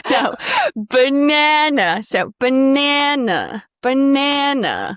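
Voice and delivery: banana very slow, loud, nasal stressed na; milk the stressed nana; overdone banana. Shown as a demonstration. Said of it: "we do wanna overdo it".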